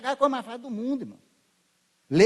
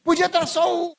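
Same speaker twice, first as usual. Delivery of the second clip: loud, ringing pulpit preaching; fervent preaching; 6 semitones higher, 5 dB louder than usual. Acted. Preacher exhorting the congregation, full force.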